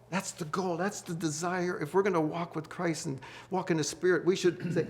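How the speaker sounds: ordinary delivery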